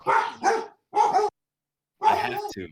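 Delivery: very high, loud insistence; high strained resolve. Acted. Zane insisting to his cousin, high and strained. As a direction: force it out high and determined. Voiced cousin, farewell scene.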